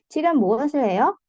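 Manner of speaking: brisk question, 해요 rising at the end; question intonation. Shown as a demonstration. Teacher contrasting question with answer, rising intonation on 해요. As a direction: ask it quickly, lifting 해요. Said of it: "Kalau pertanyaan nadanya naik".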